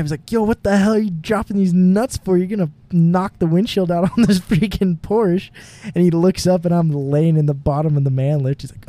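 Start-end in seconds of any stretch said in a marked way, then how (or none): 0.3-5.5 high-pitched retold scolding, little extra volume; mock yelling. Foreman yelling up at worker about falling nuts, mock scolding. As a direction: imitate an exasperated foreman's yell, pitched up. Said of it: "so he's yelling at me".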